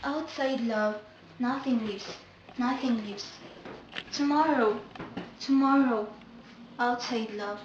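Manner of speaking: lost in a faraway dream; delirious murmur. Acted. Sabina drifts alone in delirium, soft dreamy murmur.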